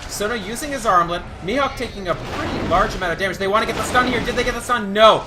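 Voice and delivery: quick-fire esports commentary, surging at key moments; live play-by-play. Real, not acted. Caster to viewers as a chase unfolds, excited play-by-play.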